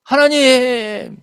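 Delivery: high, drawn-out whine to God; hammed-up tearful appeal, stretching the last syllable; mock snivelling plea. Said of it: "그러니까 짠 거예요, 질질".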